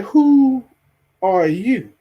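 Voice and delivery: high, startled "Who are you?" at a stranger; indignant surprise. Pretend. Homeowner finds stranger in his house, startled and indignant. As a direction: catch an intruder on your sofa, outraged. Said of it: "I'm gonna be like".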